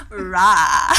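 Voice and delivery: low, loud blurted Rah; mock blurted Rah. Retelling how the friend blurted Rah, low and loud. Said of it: "the volume, the pitch, everything".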